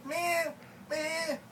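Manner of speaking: high mock baby bleat; baby whine. Imitating the baby brother, high baby bleat. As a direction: bleat like the infant. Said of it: "You gonna shut up".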